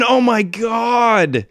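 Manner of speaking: high, loud, drawn-out dismay; loud exasperated cry. Acted. Game master reacting to Judas missing again, loud exasperated cry. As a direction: wail it slowly and loudly at the miss.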